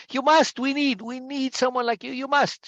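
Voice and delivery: high pleading, insistent; passionate. A narrator's reading. Big woman shaking Ursula, high passionate plea. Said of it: "A big woman's very passionate".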